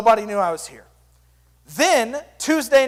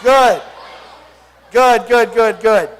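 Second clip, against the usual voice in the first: 1 loud praise; 5 dB louder, slower than usual. Real, not acted. Praising crowd response.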